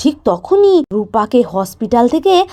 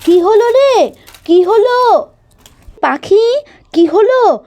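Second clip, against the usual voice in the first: raised, higher call from another room; a busy mother calling back to her child; mother calling out what happened; 8 semitones higher, slower than usual. Acted.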